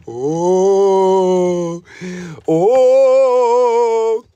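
long rising-intensity ghostly "oooh"; playful spooky ooh. Pretend. Scaring viewer by coming close, long spooky oohs. Looming toward screen to scare viewer.

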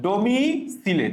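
ডোমিসিলে slowly as "domi, si-le"; spelling pronunciation. Shown as a demonstration. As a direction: pause after ডোমি in ডোমিসিলে. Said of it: "আমি এভাবে বলি".

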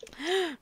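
theatrical gasp; mock gasp. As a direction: gasp in fake shock. Reacting to news Robert is dead.

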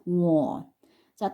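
usual speaking voice

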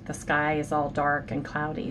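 ordinary talk, nothing marked

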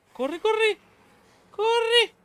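very high panicked urging. Real, not acted. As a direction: squeal at yourself to run. Fleeing enemies. (pánico, muy agudo)

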